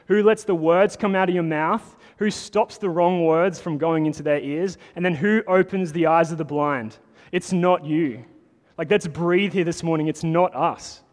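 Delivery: loud, high, driving sermon climax; preaching at full force. Acted. Pastor rousing the congregation, loud and driving. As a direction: press each question home to the room.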